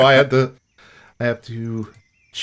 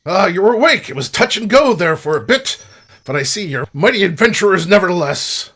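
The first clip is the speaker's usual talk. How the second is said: louder, lifted voice of a hearty rescuer; friendly rogue, welcoming; 4 semitones higher, 6 dB louder than usual. Acted. Hooded rescuer reassures adventurers, hearty.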